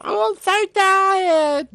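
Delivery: high, slow, drawn-out sleepy moan; yawning over-the-top tiredness; mock sleepy groan. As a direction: stretch it out like someone just woken up. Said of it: "pretend sleep".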